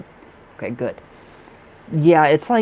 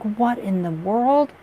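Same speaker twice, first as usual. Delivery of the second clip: astonished self-quote; 7 semitones higher, slower than usual. Retold or imitated. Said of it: "I'm like".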